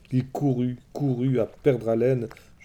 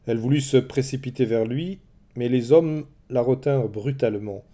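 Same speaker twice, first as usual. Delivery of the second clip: plain reading voice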